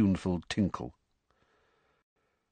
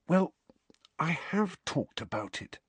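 whisper; 7 semitones higher, 7 dB quieter than usual. A narrator's reading. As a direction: keep it barely above a whisper. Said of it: "Harry said in a whisper".

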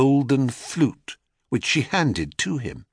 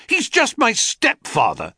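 indignant cry; 13 semitones higher than usual. A narrator's reading. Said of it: "cried Phaeton".

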